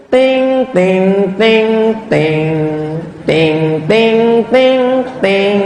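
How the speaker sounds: sung high airport chime notes; ding the announcement bell; chime imitation. Preacher imitating an airport chime, sung ding-dong notes.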